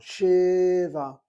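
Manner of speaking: "shiva" sounded out high and loud, two syllables; "shiva" called out clearly as shi-va; sounding out words. Shown as a demonstration. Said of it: "you think of a syllable by the sound".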